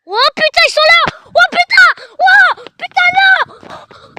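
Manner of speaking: loud, high, panicked shouts at the ghosts. Real, not acted. Sees the ghosts right there and shouts in panic. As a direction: yell in real fright as they appear.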